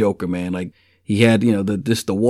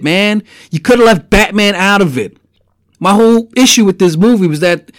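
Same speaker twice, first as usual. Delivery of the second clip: higher and louder, fed-up outburst; exasperated rant; 7 semitones higher, 4 dB louder than usual. Real, not acted. Fan ranting to viewers about the Batman origin, exasperated and raised. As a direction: vent it like a betrayed fan, pitched up.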